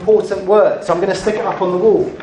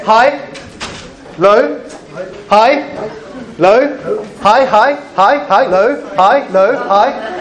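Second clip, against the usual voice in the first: calling out game; 4 semitones higher, 3 dB louder, much slower than usual. Shown as a demonstration. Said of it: "Let's give that a go".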